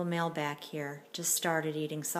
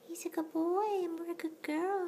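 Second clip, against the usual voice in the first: high squeaky pet voice; 11 semitones higher than usual. Real, not acted. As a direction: coo at the kittens like babies. High baby talk to kittens.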